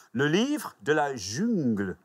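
slow, savoured French title; deliberate French pronunciation; French accent. Acted. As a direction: draw it out in showy French. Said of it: "a French accent, though, don't you".

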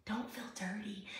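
"Don't feel dirty" breathed as a soft unvoiced whisper; tender whispered reassurance. Shown as a demonstration. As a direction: drop to a hushed whisper on "dirty". Pastor comforting viewers.

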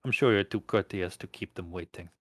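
put-on velvety, lightly amused Russian accent. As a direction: purr it in a soft Russian accent. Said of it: "the Russian accent amused".

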